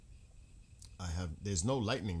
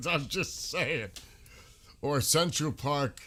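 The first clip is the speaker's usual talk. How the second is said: laughing; 6 semitones higher, 6 dB louder, slower than usual. Real, not acted. Joking about dangerous parks at night.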